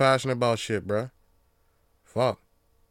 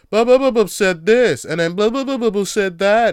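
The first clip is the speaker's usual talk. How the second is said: loud, high mocking blah blah; mock dialogue tags; 7 semitones higher, 8 dB louder than usual. Mocking the publisher's dialogue-tag demand, loud and high. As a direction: sneer out the blah blahs, higher and louder. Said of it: "so I gotta say".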